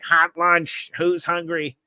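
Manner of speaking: high-pitched imitation of a school lunch guy; eccentric lunch guy. Eccentric lunch guy calls students to hot lunch, high imitated call. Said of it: "he would say it like".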